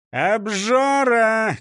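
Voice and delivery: long, loud, high-pitched cry; shouting. Acted. Chief yells at driver from inside a sack, drawn-out shout. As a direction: stretch the accusation into a shout. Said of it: "крикнул шеф из мешка".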